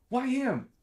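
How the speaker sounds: high, loud indignant cry; mock outrage of the extras; indignant why him. Background actors protest.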